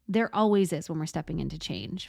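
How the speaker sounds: normal talking voice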